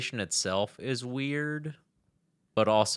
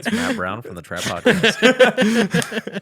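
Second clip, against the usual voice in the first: laughing; 13 semitones higher, 12 dB louder, much slower than usual. Acted. Mock announcing with laugh.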